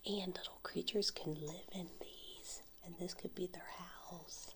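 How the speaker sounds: soft ASMR whisper over tapping; whisper with nail taps. Real, not acted. ASMR whisper showing coral shells.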